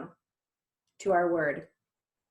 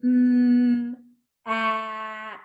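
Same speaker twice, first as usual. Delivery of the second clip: "M, at" with each sound held and separate; sounds slowly; 5 dB louder than usual. Shown as a demonstration. Teacher touches each letter for children, "M, at" sounded slowly. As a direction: hum the M long, then stretch "at". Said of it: "say our sounds slowly".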